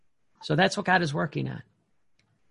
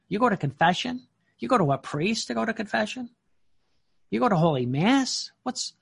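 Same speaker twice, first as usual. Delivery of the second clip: higher-pitched incredulous questioning; mock ridicule; 5 semitones higher than usual. Family and friends ridiculing the devout, mock incredulity. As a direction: needle them with mocking, disbelieving questions. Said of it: "laughed at, ridiculed".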